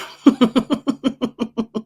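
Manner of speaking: drawn-out run of chuckles; laughing. Real, not acted. Reactor laughing at this guy's nerve, long chuckle. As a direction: laugh in disbelief, letting it run on.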